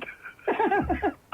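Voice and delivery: high laughing burst; real laugh. Real, not acted. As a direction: let the laugh explode. Caller laughing at magazine joke.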